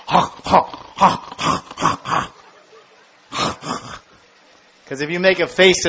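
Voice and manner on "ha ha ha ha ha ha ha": voiceless panting monkey ha-ha; mock a monkey laughing back, fading; imitating a cheeky monkey's huffing laugh, fading away. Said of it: "the monkey goes".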